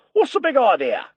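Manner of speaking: mock challenge. Bert replaying how he confronted Maria, a mock loud challenge. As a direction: bark it at the intruder, half in fun.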